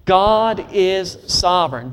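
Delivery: God is sovereign, slow with weight on God; slow emphatic declaration. Shown as a demonstration. Preacher modeling the line for the congregation, slow and emphatic. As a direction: lay heavy stress on God, slowly. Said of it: "Say it one more time".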